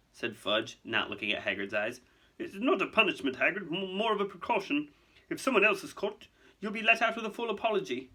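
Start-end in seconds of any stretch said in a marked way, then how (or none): 2.4-8.1 high, hesitant bureaucrat; placating official. Acted. Fudge reassuring Hagrid about his detention, placating. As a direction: soften bad news uneasily.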